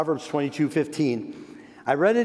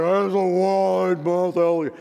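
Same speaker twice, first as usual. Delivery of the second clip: lightly voiced alligator, a bit louder; storybook alligator voice; 4 semitones higher, 4 dB louder than usual. Grandpa reading a picture book, lightly voiced alligator. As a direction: ham up a wide-mouthed gator for kids. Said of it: "kind of taking on the character".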